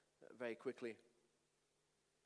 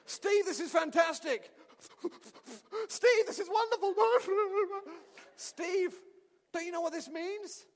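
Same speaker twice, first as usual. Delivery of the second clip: joyful shout; 10 semitones higher, 9 dB louder than usual. Retold or imitated. Pastor shouts with joy at the tearful student's news. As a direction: throw it out with delight.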